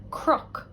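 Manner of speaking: crook said as /krʌk/, the c and n blended to a rolled cr; Irish pronunciation. Shown as a demonstration. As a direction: crook with cr in place of written cn. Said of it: "the word for hill, I would say".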